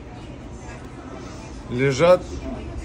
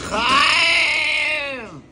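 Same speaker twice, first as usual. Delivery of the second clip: shouting; 8 semitones higher than usual. A narrator's reading. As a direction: shout the name across the street. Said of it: "кричит".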